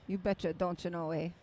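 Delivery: put-on You betcha, don't you know in a thick upper Midwest accent. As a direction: ham up the Minnesotan folksiness. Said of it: "upper Midwest accent".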